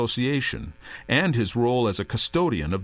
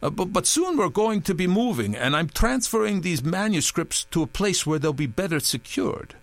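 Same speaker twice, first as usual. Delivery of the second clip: nervous Touré; 7 semitones higher than usual. A narrator's reading.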